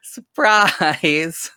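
high, drawn-out sing-song reveal; mock surprise announcement. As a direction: stretch it out like a playful big reveal. Acts out surprise pregnancy announcement.

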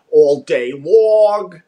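drawn-out, exaggerated Long Island vowels; Long Island accent. Shown as a demonstration. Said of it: "that's a Long Island word".

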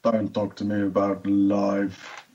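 slow, droning character voice; character impression. Guest doing an old-technique impression for the hosts, slow character voice. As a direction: draw the words out wearily. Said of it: "combination of an older impression".

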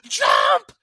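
high yelled commands across the water; screaming. Retold or imitated. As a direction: yell at her to jump, loud and high. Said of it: "I'm screaming at her".

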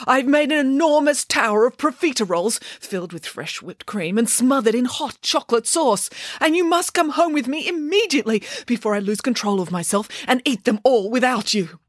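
high, gushing storybook nanny; eager, breathless-with-anticipation announcement; excited urging. A narrator's reading. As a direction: rush them home with high-pitched excitement. Excited nanny urges children home.

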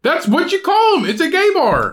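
high-pitched, defensive insistence; indignant protest. Real, not acted. Protests being told it is malicious.

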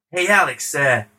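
a rough attempt at someone else's accent. Star greeting the producer at a party, bad impression. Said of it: "This is a really terrible [name] accent".

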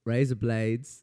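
demonstrated mock Australian accent. Shown as a demonstration. Confirming the trick for co-host, Australian accent. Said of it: "razor blades in an Australian accent".

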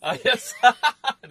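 high, loud burst of laughter; laughing. Real, not acted. Partner recalls a funny shout from the story, laughing loudly. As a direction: laugh out loud as the memory hits.

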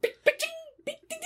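high chirpy beeps and dings; sound effect imitation. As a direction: mimic the cheap bullet sounds.

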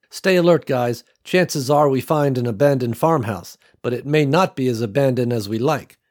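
steady warning to the crew; Nicole's radio voice. Acted.

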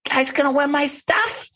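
very high-pitched mocking impression; exaggerated high, eager mimicry; Teresa. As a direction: squeal the question in a put-on voice. Mimicking Teresa asking the group.

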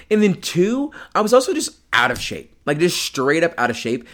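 ordinary talk, nothing marked

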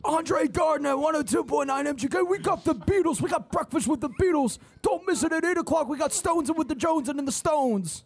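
high, singsong shouted DJ station break; Andre Gardner radio impression. Host redoing the drive-time DJ on request, high hype voice. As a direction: rattle off the lineup as a hyped-up rock DJ. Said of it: "Can I get that impression again".

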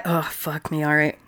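ordinary talk

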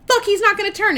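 high, loud retold outburst; mock alarm. Dracula realizes the kid won't turn, acted alarm. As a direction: burst out in sudden acted alarm.

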